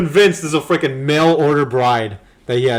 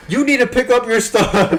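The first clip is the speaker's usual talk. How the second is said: loud, high pretend nag; raised-voice wifely scolding, put on; mock nagging; 10 semitones higher, 7 dB louder than usual. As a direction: nag him as an imagined wife. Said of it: "how does she, like, nag him?".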